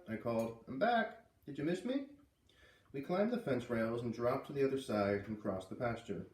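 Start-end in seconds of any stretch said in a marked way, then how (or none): 0.7-2.1 high, eager calling voice; cheerful call. Acted. Girl greeting her cow from across the field, high cheerful call. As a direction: call to her like an old friend.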